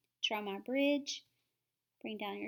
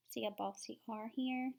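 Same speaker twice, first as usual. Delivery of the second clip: ordinary talk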